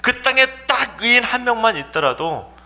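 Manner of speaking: high challenge falling at the end; arguing appeal. Acted. Imagined Abraham arguing for Lot, high insistent protest. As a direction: dispute it right down to one person. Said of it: "거기까지 따져야 되지 않겠습니까".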